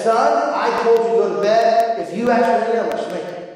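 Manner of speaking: usual speaking voice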